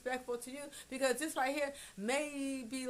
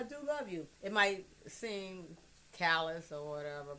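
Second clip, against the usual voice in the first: usual speaking voice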